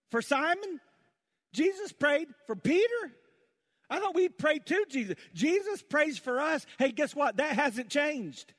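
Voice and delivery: high, loud, amazed proclamation; full-force preaching. Acted. Preacher amazed that Jesus prays for us, full-force preaching. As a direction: hammer each question home to the crowd.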